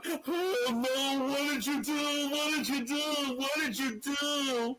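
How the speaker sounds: high, slow, pleading mock cry; imitating a panicked Jey. Retelling Jey's reaction to his brother's kick, high slow plea. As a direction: whine it up high like a stunned brother begging for answers. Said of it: "Jey is sitting there going".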